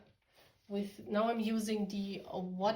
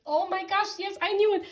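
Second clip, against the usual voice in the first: excited; 11 semitones higher, 6 dB louder than usual. Real, not acted. Card confirms her prediction.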